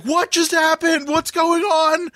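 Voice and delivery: high, puzzled mock bewilderment, slowing at the end; mock confused crowd. Bar patrons puzzled by his outburst, high mock confusion. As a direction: play the baffled bar crowd. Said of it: "everyone's like".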